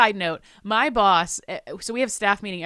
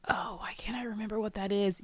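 whisper; 9 dB quieter than usual. Retold or imitated. Retold puzzled thought.

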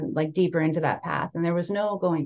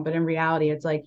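ordinary talk

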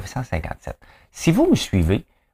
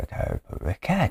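chuchoté; 8 semitones higher, 8 dB quieter than usual. Real, not acted. Chuchote avant de se calmer.

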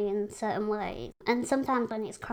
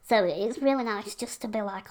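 ordinary delivery

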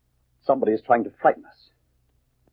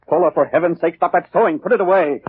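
angry order; 6 semitones higher, 4 dB louder than usual. Acted. Husband snaps at sewing wife.

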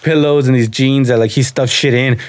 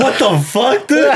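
higher, laughing through a mock protest; laughing retold outrage; 10 semitones higher, slower than usual. Brother in gorilla mask gets kicked, laughing mock outrage. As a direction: imitate the shocked brother while cracking up. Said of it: "My brother was like".

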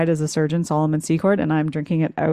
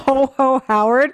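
slow, higher Santa-style chant; jolly ho ho ho; 7 semitones higher, 5 dB louder, slower than usual. Pretend. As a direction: sing it out like Santa. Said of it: "that needs to be a meme".

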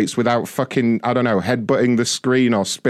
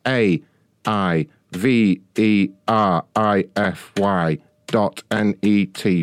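slow spelling out; 6 semitones lower, much slower than usual. Shown as a demonstration. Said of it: "I'm gonna show you how quick".

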